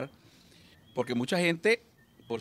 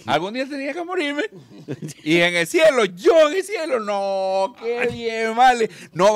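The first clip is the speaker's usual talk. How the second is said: put-on high, loud giddy drunk voice; squeaky tipsy man thrilled to be in heaven; excited drunk; 8 semitones higher, 4 dB louder than usual. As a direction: gush like a happy drunk. Drunk excited about being in heaven.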